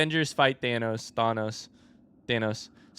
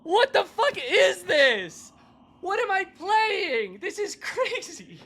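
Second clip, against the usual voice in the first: high, slow, stretched and laughing; bewildered disbelief; 13 semitones higher, much slower than usual. Real, not acted. Gamer stunned by the chaos, high slow laughing disbelief. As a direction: ask in dazed disbelief.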